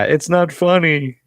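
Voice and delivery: high, slow, sulky whine; whiny protest. Retold or imitated. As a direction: drag it out like a sulking kid. Josh with a swollen face.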